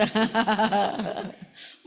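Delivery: a big real laugh; hearty laugh. Real, not acted. Preacher laughing at the woman's words to the congregation, hearty. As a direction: laugh out loud at the punchline.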